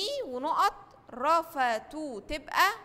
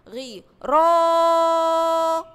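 drawn-out syllables, higher and louder; stretched out slowly; 5 semitones higher, 10 dB louder, much slower than usual. Shown as a demonstration. Teacher sounds out the syllables for the class, drawn out slowly. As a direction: hold each syllable of غي را long, higher and louder. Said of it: "غي ده حرف متحرك".

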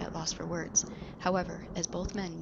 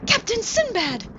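much higher, slow gleeful call; excited cry; 10 dB louder than usual. Acted. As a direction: cry out in wonder. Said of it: "as he exclaimed".

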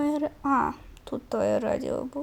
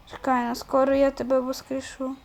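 ordinary talk, nothing marked

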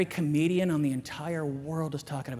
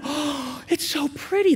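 put-on awed child; 9 semitones higher, slower than usual. Daughter awed by the dunes.

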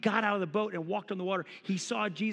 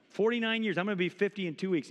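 normal talking voice

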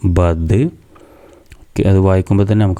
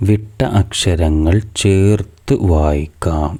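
slow, careful reading; much slower than usual. Shown as a demonstration. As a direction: read the title slowly, word by word. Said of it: "ഇനി മൂന്നാമത്തെ activity നോക്കൂ". (പതുക്കെ, ശ്രദ്ധിച്ച് വായിക്കുന്നു)

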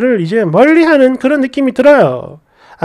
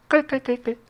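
very soft read-out laugh; mock cackle; 12 dB quieter than usual. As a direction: murmur a half-hearted fake cackle. Reads out a commenter's laugh.

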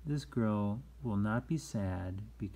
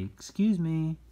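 higher, louder modelled excuse me; polite apology; 7 semitones higher, 6 dB louder than usual. Shown as a demonstration. Teacher models manners after a bump, polite apology. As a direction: sing it out clearly as an apology.

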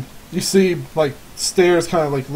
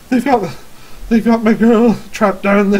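higher, louder pleading father; distressed old villager; 6 semitones higher, 6 dB louder than usual. Acted. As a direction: cry out for help for your trapped girl. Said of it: "man standing with a, like, pitchfork".

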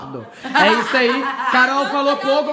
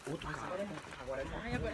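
whispering; 10 semitones lower, 22 dB quieter, faster than usual. Real, not acted. Whispering about passing cars at night.

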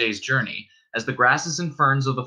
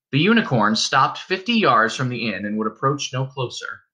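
ordinary delivery

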